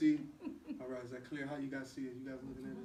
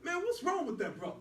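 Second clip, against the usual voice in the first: high, raised indignant voice; indignant rant; 10 semitones higher, 6 dB louder than usual. Acted. Brother ranting high and loud about Hubie looking down on them. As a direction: vent hot outrage.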